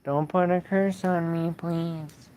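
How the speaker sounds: pleading. Real, not acted. Streamer to the owl said to be a witch, pleading. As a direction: beg to be spared.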